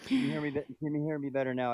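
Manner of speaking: distant bad mic; sounding far from the mic. Real, not acted. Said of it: "you just sound a little far away".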